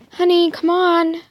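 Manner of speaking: high, loud call; mom calling out. A narrator's reading. Mom calls her child over from afar, calling out. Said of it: "It sounded like my mom".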